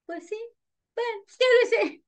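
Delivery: imitated mother giving in, high voice; mamá consintiendo. (imitando a la mamá que accede, voz aguda)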